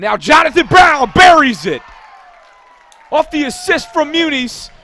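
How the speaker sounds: full-out, high roaring goal call; goal-call shout. Real, not acted. Commentator calling the go-ahead goal at Homewood Field, shouting. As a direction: shout the goal at the top of your voice.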